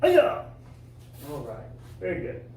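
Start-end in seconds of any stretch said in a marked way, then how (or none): 0.0-0.4 piercing high yell on the technique; kiai shout. Shown as a demonstration. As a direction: snap out the yell with the move. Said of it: "Number five is like this".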